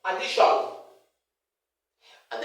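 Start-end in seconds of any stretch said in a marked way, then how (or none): 0.0-0.4 addition said a-di-SHON, loud full final vowel; wrong "shon" ending. Retold or imitated. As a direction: imitate a teacher saying addition with SHON. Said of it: "the other teacher said".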